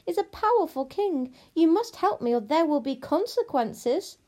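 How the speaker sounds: high, loud, imperious demand; threatening princess. Acted. Princess threatens the witch with consequences, imperious. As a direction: issue a haughty threat, pitched high.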